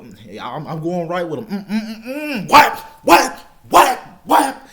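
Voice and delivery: rising loud punch sounds; mock fight noises. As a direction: follow him and hit. Acting out the fight.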